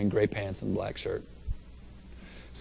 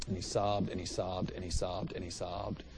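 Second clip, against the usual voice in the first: each phrase softer than the last, fading down; fading repetition; 5 semitones lower, 11 dB quieter than usual. A narrator's reading. Describing the man's weeping to the crowd, fading to a hush. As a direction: let it sink to a hush. Repeating sobbed, fading reverently.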